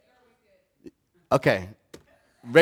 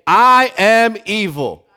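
loud call to repeat; 5 semitones higher, 8 dB louder, slower than usual. Shown as a demonstration. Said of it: "I want everybody to say this".

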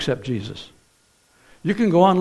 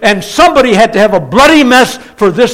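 loud, high, driving sermon climax; full-force preaching; 6 semitones higher, 9 dB louder than usual. Real, not acted. Preacher announcing the rapture to his congregation, full-force preaching. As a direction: proclaim it with building urgency to the room.

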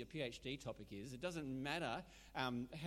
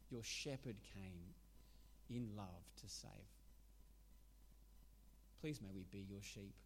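prayer; 3 semitones lower than usual. Acted. Closing prayer.

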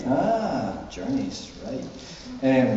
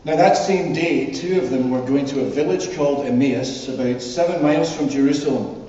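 measured public Bible reading, lifted and deliberate; scripture reading; 4 semitones higher, 4 dB louder than usual. Acted. Minister reading the Emmaus Road story to the congregation, scripture reading. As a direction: read it out clearly to a congregation, phrase by phrase. Reads the Bible passage aloud.

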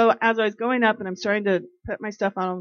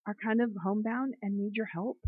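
ordinary delivery

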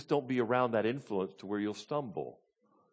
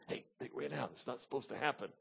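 much softer, confiding imitation of worry; hushed concern; 12 dB quieter than usual. Mary quietly telling Jesus about the wine, hushed concern. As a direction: drop the volume, as if quietly alerting someone. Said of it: "Mary's concern goes to Jesus".

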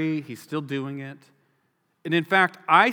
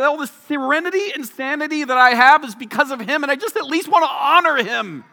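high, impassioned plea building louder; frustrated pleading; 8 semitones higher, 5 dB louder than usual. Retold or imitated. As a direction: beg insistently, voice pitched up with exasperation. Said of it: "she was so frustrated".

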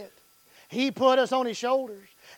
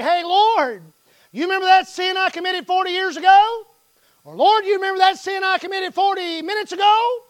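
voicing a believer; 8 semitones higher, 9 dB louder than usual. Believer voiced praying to Lord.

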